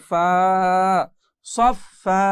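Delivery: final ফা lengthened, then সফা with a drawn last vowel; one-alif stretch. Shown as a demonstration. Teacher building the word, সফা with lengthened ending. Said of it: "সেটাকে এক আলিফ টেনে পড়তে হয়".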